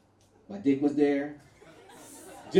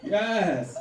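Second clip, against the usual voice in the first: ordinary delivery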